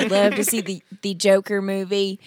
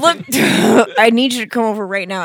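urgent demand; 2 semitones higher, 10 dB louder, faster than usual. Acted. Desperate prank caller.

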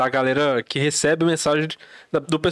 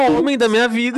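higher mock-smitten woman's reply; swooning woman; 6 semitones higher, 4 dB louder than usual. Woman turns to answer her catcaller, mock swoon. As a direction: play the instantly smitten woman, a bit hammed-up. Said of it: "a mulher vai virar e falar assim".

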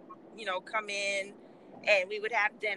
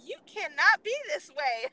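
mom scolding; 8 semitones higher, 5 dB louder than usual. Retold or imitated. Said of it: "My mom was like".

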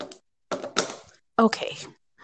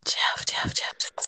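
whispering; 11 dB quieter, slower than usual. Acted. Breathes out Jeff as told.

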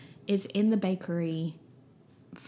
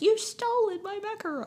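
mock accusation; 12 semitones higher than usual. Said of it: "she's like".

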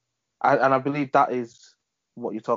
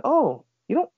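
much higher, mock-surprised caller; surprised customer; 8 semitones higher, 3 dB louder than usual. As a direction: squeak it out like the startled customer. Customer's surprised remark, retold.

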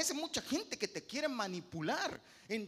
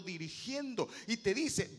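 ordinary talk

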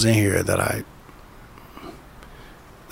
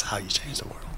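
whispered emphasis; 10 dB quieter than usual. Real, not acted. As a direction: breathe the line out softly. Repeated softer as emphasis.

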